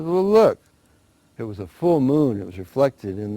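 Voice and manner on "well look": higher, louder feigned dismay; mock alarm. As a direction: point it out with hammed-up shock. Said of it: "He says".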